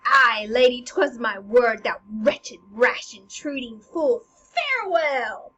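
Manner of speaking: loud, slow, scornful stage declamation; Hamlet. Acted. Hamlet to the queen and dead Polonius, loud scornful declaiming. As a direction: proclaim it firmly, drawing out the key words. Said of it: "And then Hamlet goes".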